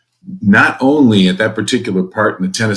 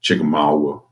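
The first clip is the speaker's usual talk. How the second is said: southern accent; 5 semitones lower than usual. Shown as a demonstration. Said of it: "you have to say with a southern accent".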